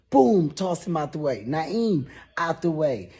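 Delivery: ordinary delivery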